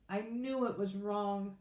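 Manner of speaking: usual speaking voice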